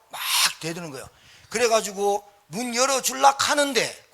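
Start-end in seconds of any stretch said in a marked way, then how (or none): 1.5-4.1 heated shout. Real, not acted. Excited telling of confrontation.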